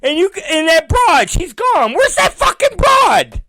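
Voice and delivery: hammed-up yelling after a missing woman; a mock indignant holler; mock outraged yell.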